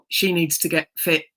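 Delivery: ordinary talk, nothing marked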